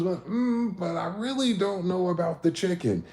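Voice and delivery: high, doubtful girlfriend voice, mock fussy; lightly mocking a picky date; hesitant girlfriend. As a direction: put on her dithering high voice. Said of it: "She goes".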